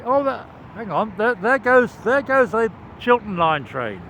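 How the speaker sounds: excited train spotting. Real, not acted.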